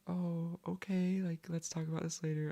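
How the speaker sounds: a hint of surprised parents putting it off; voicing her parents. Her parents answering her message.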